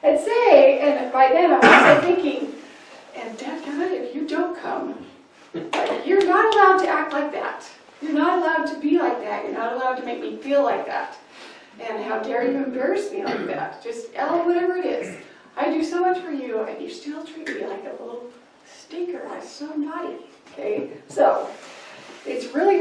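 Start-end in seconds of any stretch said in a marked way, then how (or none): 0.0-2.5 much louder, pushing harder and harder; getting really loud. Shown as a demonstration. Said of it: "and then I get really loud". 3.1-20.2 exasperated scolding parent. Acted. Parent scolding a resistant child.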